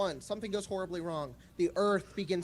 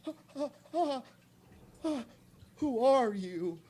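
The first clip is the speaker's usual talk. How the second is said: frightened nerd voice; 8 semitones higher, 3 dB louder than usual. Retold or imitated. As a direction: stammer it out high and scared. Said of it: "And he's like".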